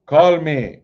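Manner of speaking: mock Jamaican psychic hotline accent; a playful Jamaican accent sales pitch. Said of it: "Jamaican accent".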